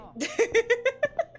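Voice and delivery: high, loud burst of laughter; delighted laugh. Real, not acted. Streamer tickled by the chat, high laughter. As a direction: cackle helplessly at a silly joke.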